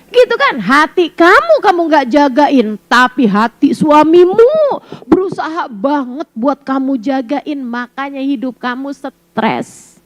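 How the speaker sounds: normal talking voice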